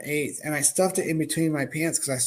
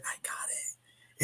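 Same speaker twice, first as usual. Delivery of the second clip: slow, hushed, unvoiced reply; slow whisper; 15 dB quieter, much slower than usual. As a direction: breathe it out slowly, keeping it secret. Secretly answering dad about weed.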